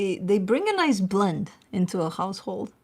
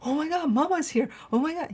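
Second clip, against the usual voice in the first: voicing excited dog; 7 semitones higher, 4 dB louder than usual. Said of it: "He was like".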